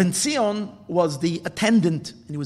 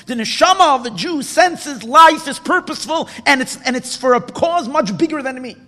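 louder, higher, impassioned sermon; rising preacher's fervor; fervent preaching; 7 semitones higher, 7 dB louder than usual. Acted. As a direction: drive the point home to the congregation.

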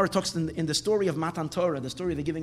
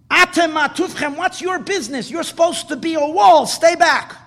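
loud, high scolding shout; yelling at the walls; 10 semitones higher, 10 dB louder than usual. Retold or imitated. Rabbi scolding the leaning walls, angry loud shout. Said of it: "yelled at the walls".

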